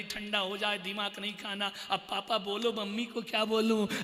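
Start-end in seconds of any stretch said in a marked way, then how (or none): 0.0-1.7 softer, meek retelling of the message; timid child relaying. Retold or imitated. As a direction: pass it on quietly. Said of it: "बोलता है, mummy कह रही".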